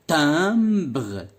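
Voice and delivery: ordinary talk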